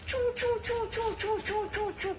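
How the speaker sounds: very high quick squeaks; mouse squeaks. A narrator's reading. Mouse scurries home to its hole, high squeaks.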